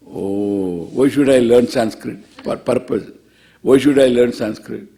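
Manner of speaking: low, loud doubting student voice; skeptical student. Retold or imitated.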